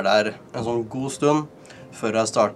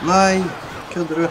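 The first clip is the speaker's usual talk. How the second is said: high, outraged disbelief; can't believe the bad luck; shocked disbelief; 7 semitones higher, 6 dB louder than usual. Real, not acted. Taper tårnet i kampen. (sjokkert og vantro)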